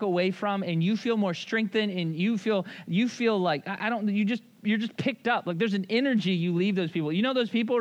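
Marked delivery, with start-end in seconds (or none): none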